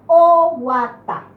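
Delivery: Oguatá slow and loud, stress on final "tá"; acento final. Shown as a demonstration. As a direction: break Oguatá into syllables, hit "tá". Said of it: "en la última sílaba". (Oguatá silabeado y fuerte, con acento en "tá")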